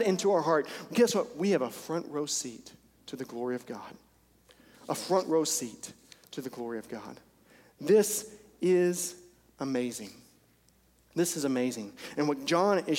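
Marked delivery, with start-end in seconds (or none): 1.4-7.2 awed. Real, not acted. Sermon turns to awe. 7.8-11.9 awed. Real, not acted. Awed repeat.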